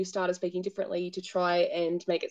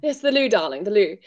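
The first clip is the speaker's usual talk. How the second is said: mock posh Brit; 8 dB louder than usual. Mocking British posh word choice.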